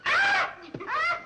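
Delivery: sudden high shriek of fright; two sharp frightened shrieks; startled scream. Acted. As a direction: scream in shock, very high and loud. Startled at the baby.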